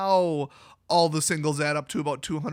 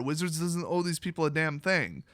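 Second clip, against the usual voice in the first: usual speaking voice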